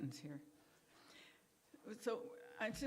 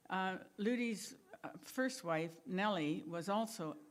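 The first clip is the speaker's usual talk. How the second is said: ordinary talk, nothing marked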